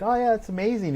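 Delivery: high, sing-song fake enthusiasm; fake happy. Wife faking praise for his leather work, high fake cheer. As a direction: gush without meaning it. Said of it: "be fake happy and be like".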